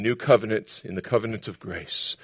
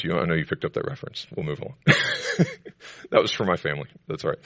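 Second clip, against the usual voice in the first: ordinary talk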